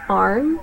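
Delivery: "iron" said as one-syllable "arm"; Southern drawl, "arm". Shown as a demonstration. Speaker demos the Southern "iron" as "arm". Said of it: "Southern version".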